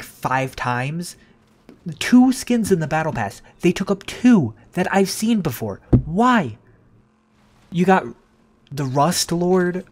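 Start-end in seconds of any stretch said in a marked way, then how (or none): 2.0-9.8 exasperated rant. Real, not acted. Ranting about battle pass skins.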